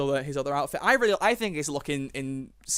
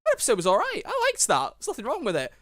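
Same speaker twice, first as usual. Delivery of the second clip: much higher mock cheerful approval; 9 semitones higher than usual. Recalling first watching the episode, high mock cheer. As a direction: voice your old naive opinion, much higher.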